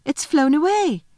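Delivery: put-on high-pitched, urgent young girl's cry. A narrator's reading. Lucy alerts the others to the robin, high excited cry. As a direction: call out in alarm, voice jumping up. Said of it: "cried Lucy".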